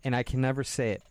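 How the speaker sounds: ordinary delivery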